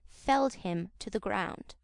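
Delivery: ordinary delivery, nothing marked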